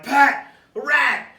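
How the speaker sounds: high loud yell of warning; shouting alarm. Retold or imitated. Retelling yelling to Pat at another rat, an alarmed shout.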